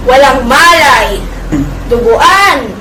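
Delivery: loud, drawn-out dramatic lament; anguished outcry. Acted. Don Juan recalling how his brothers left him bloodied, anguished outcry. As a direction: push each phrase out with force.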